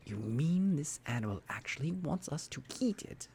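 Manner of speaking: plain reading voice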